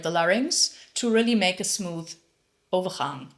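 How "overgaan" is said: Dutch word overgaan said in Dutch. Shown as a demonstration. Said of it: "they would have said on my university".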